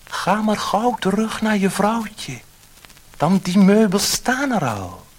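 high, loud kindly fish voice; high fish voice, slowing at the end; magic fish. A narrator's reading. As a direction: grant the wish like a gentle magic fish. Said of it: "het stemmetje zei".

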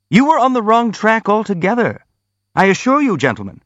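urgent, high-pitched objection; crying out. A narrator's reading. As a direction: cry out in earnest to the whole company. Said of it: "cried the prince".

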